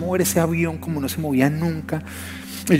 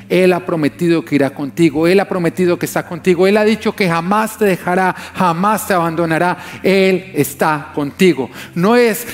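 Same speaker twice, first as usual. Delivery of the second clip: builds higher and louder into full preaching; rising sermon; 5 semitones higher, 5 dB louder than usual. Acted. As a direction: climb until you're preaching at full force.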